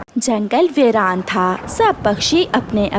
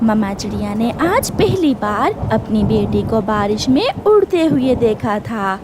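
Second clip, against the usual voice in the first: ordinary delivery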